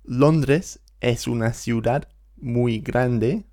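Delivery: slow, careful reading; slow model sentence. Shown as a demonstration. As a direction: read each word slowly. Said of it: "let's take another look at those sentences".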